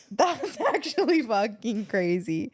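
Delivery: laughing and slow. Real, not acted. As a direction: say it through laughter. Laughing at date story.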